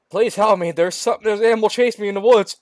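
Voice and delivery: high, loud, fast panicked plea; panicked shout. Acted. Girl tells the sheriff an animal chases her, panicked shout. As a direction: blurt it out in complete panic. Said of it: "shouted Alice in complete panic".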